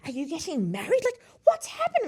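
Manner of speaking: high, excited mock British accent; animated British lady. British lady meeting a bridal party in the elevator, animated British accent. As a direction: pitch it up like an animated British woman. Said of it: "genuinely lit up and animated".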